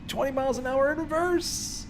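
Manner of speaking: high-pitched, climbing whoop of words; gleeful exclamation. Real, not acted. Streamer racing a tractor backwards, high gleeful call. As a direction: crow it high, stretching the last word.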